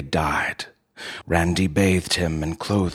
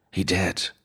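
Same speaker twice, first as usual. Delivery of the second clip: ordinary delivery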